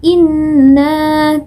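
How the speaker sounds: "inna" chanted with a held, moderate n; medium letter articulation. Shown as a demonstration. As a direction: hold the doubled n in "inna", neither hard nor weak. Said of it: "mengucapkannya harus sedang ya". ("inna" dengan nun sedang dan berdengung)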